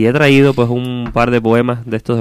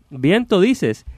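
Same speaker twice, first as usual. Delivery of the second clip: high, quicker acted retort; puzzled carter; 8 semitones higher, much slower than usual. Carter questioning what the hidden voice said, incredulous. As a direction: repeat the word back in disbelief.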